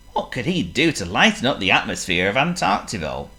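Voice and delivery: plain reading voice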